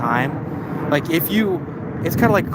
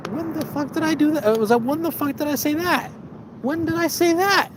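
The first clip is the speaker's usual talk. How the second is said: squeaky high disbelief, much higher in pitch; high-pitched mock outrage; 13 semitones higher than usual. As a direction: play yourself as baffled, in a shrill squeak. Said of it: "I'm like".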